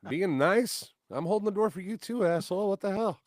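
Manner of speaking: high, loud exasperated retort; retold comeback to the angry man, high and loud; indignant retort. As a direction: throw it back at him, fed up.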